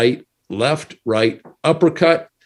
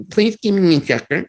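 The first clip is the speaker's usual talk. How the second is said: higher, mock little-kid begging for a sucker; childish plea; 9 semitones higher than usual. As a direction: beg sweetly like a small kid asking for candy.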